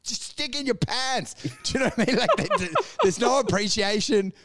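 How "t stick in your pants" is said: much higher, hammed-up shushing; mock coworkers shutting Larry up; mock scolding. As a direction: tell Larry off dismissively. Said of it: "but they're like".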